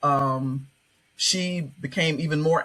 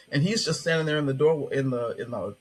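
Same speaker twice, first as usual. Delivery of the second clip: ordinary delivery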